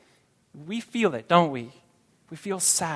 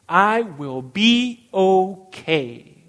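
slow, much louder, firm promise; loud declaration; 10 dB louder, much slower than usual. Acted. Pastor assuring the congregation, slow and loud. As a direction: declare it out loud, slowly and with full conviction.

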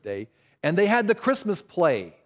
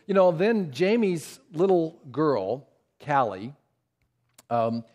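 ordinary talk

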